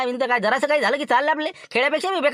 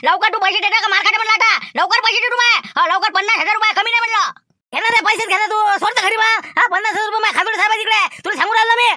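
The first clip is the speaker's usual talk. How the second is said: loud, higher heated shouting over money; staged angry quarrel; 10 semitones higher, 6 dB louder than usual. Acted. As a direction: yell like you're about to grab his collar. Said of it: "जसं केलं नाटक".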